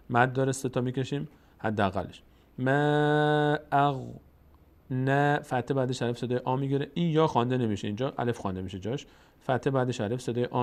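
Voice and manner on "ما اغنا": slow, drawn-out tajwid recitation; Quran recitation. Shown as a demonstration. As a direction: chant each word slowly. Recites verse.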